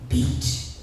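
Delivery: ordinary talk, nothing marked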